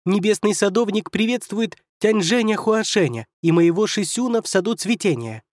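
high, yapping little fox; barking announcement. A narrator's reading. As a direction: yap it out like a proud fox cub. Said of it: "пролаял".

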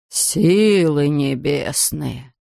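low, very slow aghast exclamation; shocked gasp. A narrator's reading. Factory owner sees barrels falling, low slow gasp. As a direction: breathe it out stunned. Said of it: "ахнул сэр Хантли".

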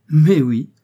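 loud, high cry of "Mais oui!"; an old lady's delighted outcry; exclaiming. A narrator's reading. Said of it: "s'écria madame Pascal".

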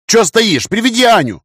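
loud, high-pitched urgent command; sharp, panicked shout; barked order. A narrator's reading. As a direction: bark it out at someone frozen on the spot. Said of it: "рявкнул я".

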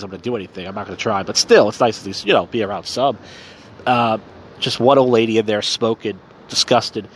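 usual speaking voice

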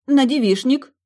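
loud, high soldierly report; reporting in chorus. A narrator's reading. Friends answering Milly like soldiers, loud report. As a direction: bark it out like recruits. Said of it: "хором отрапортовали".

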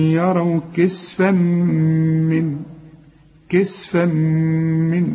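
كسفا من slowly with a nasal ghunna; tajweed recitation with ghunna. Shown as a demonstration. Teacher demonstrating ghunna slowly. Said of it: "لازم تغن".

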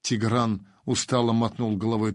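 plain reading voice

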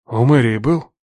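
lightly voiced cold stern father; icy father's question. A narrator's reading. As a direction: ask it coolly, like an interrogation. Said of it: "с той ледяной ясностью".

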